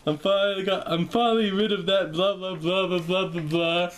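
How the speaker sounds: lightly voiced cheering producers; playful mock farewell; mock relieved producers. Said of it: "they're going".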